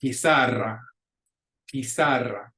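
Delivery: pizarra with the stress on -ZA-; stress on middle syllable. Shown as a demonstration. Teacher showing the accent rule, pizarra stressed on the middle syllable. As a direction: model pizarra in Spanish, leaning on the middle syllable. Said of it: "is the middle syllable".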